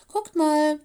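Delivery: high, loud attention call. A narrator's reading. Lola spotting the old tree ahead, calling out excitedly. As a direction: call the others over to look.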